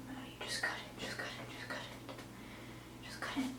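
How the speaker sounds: hushed, very soft whisper; whispered self-urging. Real, not acted. Vlogger urging herself to just cut it, whispered. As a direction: whisper a nervous pep talk to yourself. Psyching herself up before cutting.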